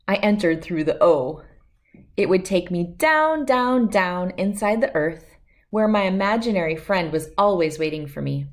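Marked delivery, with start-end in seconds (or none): none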